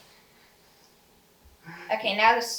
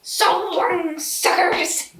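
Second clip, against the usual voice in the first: high, loud mock villain cry; goofy cartoon villain; 7 semitones higher, 7 dB louder, much slower than usual. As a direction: call it out like a gleeful cartoon baddie. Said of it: "When you said it, you, like".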